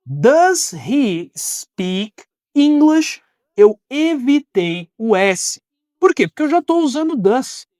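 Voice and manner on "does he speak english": very slow, every word spaced out; slow teacher demo. Shown as a demonstration. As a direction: stretch it out for learners. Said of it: "vamos agora para a pergunta".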